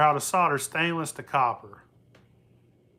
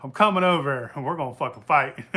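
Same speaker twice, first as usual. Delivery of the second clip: loud, raised playful threat to a friend; mock threat; 4 semitones higher, 7 dB louder than usual. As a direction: bluster like you'll come fight him, joking. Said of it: "I was like".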